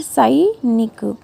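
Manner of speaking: "सैनिक" drawn out slowly as "sai-nik"; slow careful "सैनिक"; slow drawn-out demo. Shown as a demonstration. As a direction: stretch the "ai" in "सैनिक". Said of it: "दो मात्रा लगानी है".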